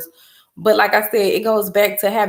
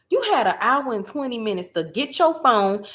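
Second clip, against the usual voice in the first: ordinary talk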